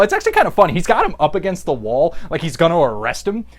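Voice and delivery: ordinary talk, nothing marked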